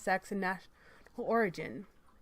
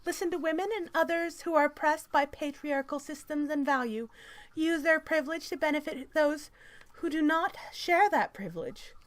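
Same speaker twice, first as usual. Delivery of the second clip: high, loud mock-activist reading voice; strident article read; 6 semitones higher, 4 dB louder than usual. Reading a feminist article aloud to mock it, strident.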